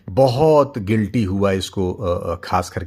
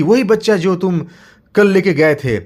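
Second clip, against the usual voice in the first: insistent father; 9 semitones higher, 7 dB louder than usual. Acted. Father answers.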